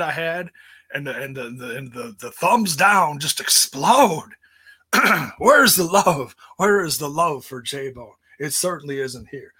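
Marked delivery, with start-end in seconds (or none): 2.3-8.1 hammed-up indignant shout, louder; mock wounded cry for love; mock outrage. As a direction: play up fake hurt at the dislikes.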